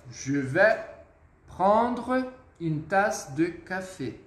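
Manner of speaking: slow, careful French demonstration; measured teacher's model for learners to copy; slow model sentence. Shown as a demonstration. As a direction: spell the sentence out by ear, word by word.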